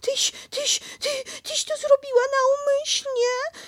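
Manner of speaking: choking on soap; sputtered. Acted. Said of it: "wykrztusił prosiaczek".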